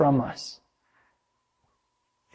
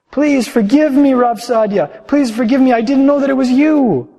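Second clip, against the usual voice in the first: much higher, louder begging; pleading, weeping; 9 semitones higher, 6 dB louder than usual. Acted. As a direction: beg forgiveness at his feet. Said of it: "fell at my feet weeping".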